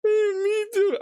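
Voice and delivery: hammed-up sobbing sorry to the onion; mock crying. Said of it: "keep going with the bit".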